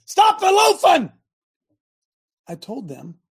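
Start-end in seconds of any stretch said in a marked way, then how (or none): 0.1-1.1 high, loud, slow shout; coach yell. Retold or imitated. Coach yelling at loafing players, loud slow shout. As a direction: yell it across the field. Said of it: "If I yelled at my football team".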